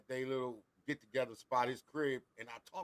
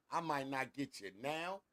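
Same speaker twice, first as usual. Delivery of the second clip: mom's mind games; 4 semitones higher, 8 dB quieter than usual. Retold or imitated. Mom warning her child of punishment, softly menacing. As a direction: draw the threat out softly, in no hurry. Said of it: "The mind games that my mom played with me".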